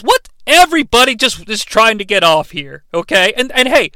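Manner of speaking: ordinary talk, nothing marked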